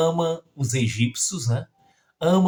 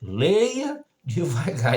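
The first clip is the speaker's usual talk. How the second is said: words stretched out slowly; drawn-out "Leia devagar"; slow teacher's instruction; slower than usual. Retold or imitated. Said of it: "Foi isso que o professor disse".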